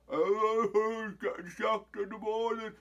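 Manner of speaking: high, loud put-on footballer voice; mocking a sacked player's cheerful reply; Phil Jones impression. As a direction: do a dopey defender answering an interviewer. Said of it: "That's my best impression".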